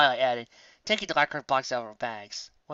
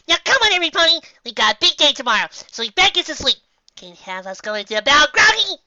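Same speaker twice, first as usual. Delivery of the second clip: louder, higher bossy farm mare; herding everyone to bed; Applejack; 13 semitones higher, 12 dB louder than usual. Acted. Applejack voiced in story.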